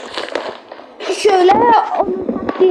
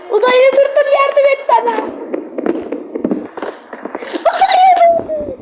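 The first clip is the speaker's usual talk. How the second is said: high laughing shout for help; being chased; 9 semitones higher than usual. Real, not acted. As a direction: shriek for help while chased. Calling for help while chased.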